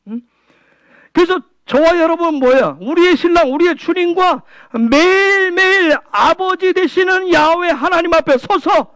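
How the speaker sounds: ordinary talk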